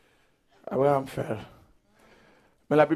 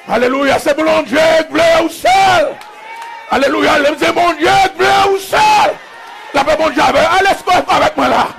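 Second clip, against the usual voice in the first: building from raised voice to high shouted refrain; full-force preaching; 8 semitones higher, 5 dB louder than usual. Real, not acted. Preacher telling lonely believers God wants them alone, full-force preaching. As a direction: drive the line home harder each time, stretching key words.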